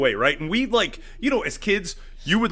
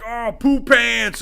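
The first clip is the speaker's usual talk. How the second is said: mock taunt; 6 semitones higher, 5 dB louder, much slower than usual. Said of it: "and they're like".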